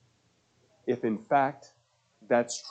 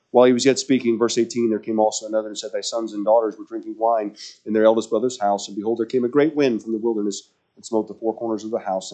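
louder, steady scripture read; 5 dB louder than usual. Acted. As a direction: read the verse out boldly. Reading scripture with force.

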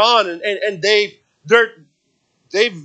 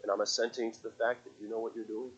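very soft, low prayer; quietly acted, settled trust in God; calm assent to God; 8 semitones lower, 14 dB quieter, faster than usual. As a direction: speak low and hushed, accepting. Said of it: "He's saying".

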